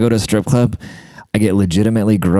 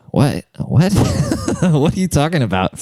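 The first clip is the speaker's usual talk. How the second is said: surprised; 7 semitones higher than usual. Retold or imitated. Shocked reaction to girl's offer.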